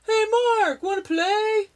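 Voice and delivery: high, loud bully voice; laughing taunt. Acted. Bully Buzz taunting Mark from the canoe, high and loud. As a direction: tease him with a laugh in the voice. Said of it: "laughed Buzz".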